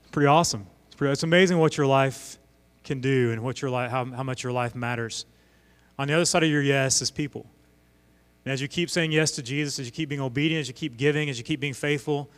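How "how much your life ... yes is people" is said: usual speaking voice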